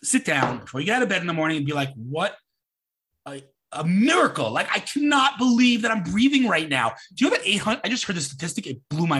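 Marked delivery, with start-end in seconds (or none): 1.9-6.9 marvel, rising high on the disbelief; amazed gratitude. Acted. Speaker modeling a grateful morning thought, amazed. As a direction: play someone awed to be alive.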